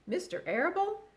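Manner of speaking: a hint of tears in the question; mild sobbing. A narrator's reading. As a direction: pig sobs about the farmer. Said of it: "sobbed Wilbur".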